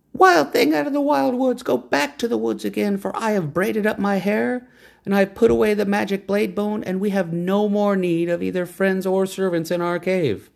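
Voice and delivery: high, proud woman's voice; lofty woman naming the horse. A narrator's reading. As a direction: announce the horse's new name.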